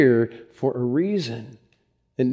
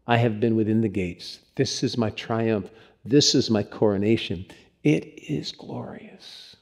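hushed, low last words fading to a whisper; dying awe; 4 semitones lower, 8 dB quieter than usual. Retold or imitated. Dying evangelist telling his son of glory, in hushed awe. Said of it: "he woke up with this on his lips".

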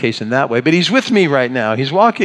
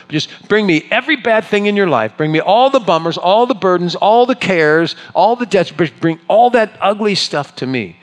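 high, eager, open-armed; eager invitation; 7 semitones higher, 3 dB louder than usual. Retold or imitated. Joy inviting every burden, eager and high. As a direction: pile it all on me, gladly. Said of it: "Joy has lived that out".

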